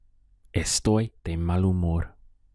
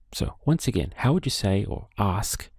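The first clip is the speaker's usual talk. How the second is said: usual speaking voice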